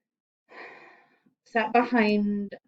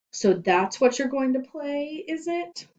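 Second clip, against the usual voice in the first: sneering principal; 9 semitones higher, 5 dB louder than usual. Acted.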